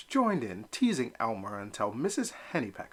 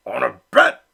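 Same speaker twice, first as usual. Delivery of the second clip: much louder, booming; thundering reply; 12 dB louder than usual. Acted. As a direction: ambush him with a roar. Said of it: "came the thundering reply".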